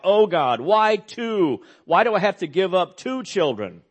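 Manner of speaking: reading a cry to God. Retold or imitated. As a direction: read her anguished plea with feeling. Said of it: "She cried out".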